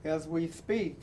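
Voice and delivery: rhythmic, building reading with falling endings; dramatic poem reading. Acted. Host reading a picture book poem to young viewers, rousing. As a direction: read the poem like a rallying call. Reads The Undefeated poem aloud.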